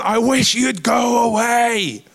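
high, slow, stretched holler; mock heckling shout. Imagined listener stands up mid-sermon, mock heckling shout. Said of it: "No one's ever stood up and gone".

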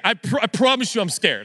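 normal talking voice

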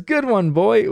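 high-pitched imitation of an eager dad; youthful energy. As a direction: mimic an energetic cheer, voice lifted high. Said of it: "with all the youthful energy".

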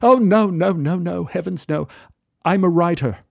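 a polite English gent's greeting; English accent. Retold or imitated. English stranger greets narrator at the bar, lightly English. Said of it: "He, he was English".

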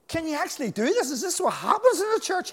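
put-on a young preacher caught off guard, voice jumping up; shocked. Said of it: "And Jerry Savelle was shocked".

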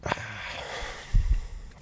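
long emotional sigh; heavy sigh. Real, not acted. Interviewee asked why they are emotional, sighing heavily. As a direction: sigh heavily before answering.

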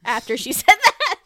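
words breaking into loud laughter; laughing. Real, not acted. Cohost laughing while teasing her friend's slip. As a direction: laugh through the end.

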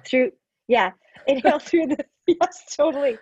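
laughing. Real, not acted. Laughing at the ASMR joke.

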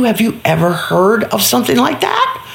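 ordinary delivery

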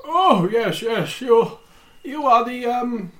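high, eager fussy voice; the banker greeting the arriving party; plump banker voice. Acted. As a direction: greet them like a nervous host. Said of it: "and he's like".

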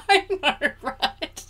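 high, loud laughing agreement. Real, not acted. Co-host laughing at a joke about lockdown, laughing agreement. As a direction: agree through a burst of laughter.

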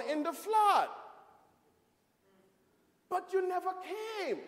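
high, slow, building to a shout; angry complaint. Acted. Drowned man confronting God, high slow shouting reproach. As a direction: accuse God, pitch rising into a shout on the flood. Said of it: "And he's angry".

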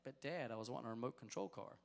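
a hint of a pleading little boy; quietly imitating a small boy asking; child voice. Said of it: "Thinks he's excited by that".

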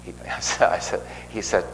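ordinary talk, nothing marked

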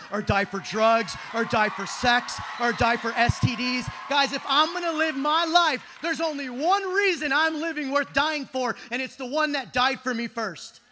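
loud, punched, impassioned declaration; full-force preaching. Real, not acted. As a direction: preach it hard, hammering each phrase.

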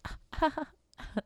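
squeaky high little fish sounds; tiny fish whispering to the boy. Pretend. Page of Cups' fish whispering to the boy, tiny squeaky voice. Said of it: "he's like".

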